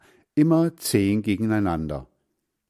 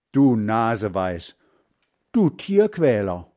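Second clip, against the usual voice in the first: name-calling; 4 dB louder than usual. A narrator's reading. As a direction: hurl insults in a squabble. Boys trading insults in quarrel. (empört schimpfen)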